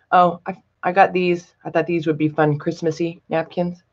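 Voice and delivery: normal talking voice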